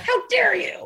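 high hammed-up indignation; mock outrage. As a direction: play the offended diva. Her mock reply to boyfriend.

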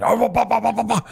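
high-pitched babble imitating a yelling man; mock yelling. Imitating a Russian man yelling at another in the sauna, mock yell. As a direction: babble like an argument in a language you don't speak. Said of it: "he starts yelling".

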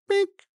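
very high electronic bink; high beep. Retold or imitated. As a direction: chirp it like a thermometer beep. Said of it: "and it goes".

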